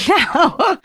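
high creature cry; hammed-up shriek of refusal; Gollum shriek. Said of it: "shrieked Gollum".

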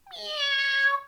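very high meow; cat meow. A narrator's reading. Cat joining the concert, high meow. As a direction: mew like a cat. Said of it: "said the cat".

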